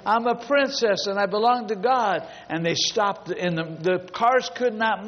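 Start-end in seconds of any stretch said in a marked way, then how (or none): 0.0-2.3 higher, lightly voiced gleeful chant; imitating joyful little girls. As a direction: proclaim it like a dancing child, mildly. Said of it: "little girls were dancing in the street going".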